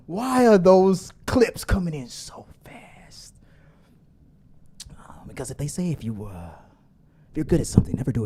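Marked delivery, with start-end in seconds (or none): 0.1-6.5 whisper. Real, not acted. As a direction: ask it under your breath. Asks quietly about incoming clips.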